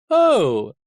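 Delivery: high, loud falling exclamation; theatrical villain's mock concern; gloating coachman. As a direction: swoop down from a high, loud start. Coachman gloats over his catch.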